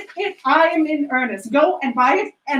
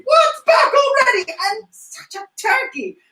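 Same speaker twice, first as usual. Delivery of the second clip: thrilled they're back so soon; delighted surprise; 9 semitones higher than usual. Acted. Surprised at the boy's return.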